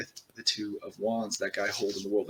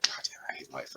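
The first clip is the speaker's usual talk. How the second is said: despairing whisper; 10 semitones lower, 9 dB quieter than usual. Retold or imitated. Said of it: "you're like".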